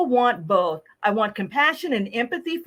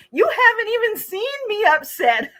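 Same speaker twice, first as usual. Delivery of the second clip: higher, laughing indignant jab; hammed-up offended customer; mock indignation; 10 semitones higher, 3 dB louder than usual. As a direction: tease like an insulted customer. Customer quoted, continuing.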